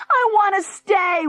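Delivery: put-on the boy weeps at the table to stay; weeping child. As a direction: sob out the plea like a sulky boy. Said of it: "wept Junior".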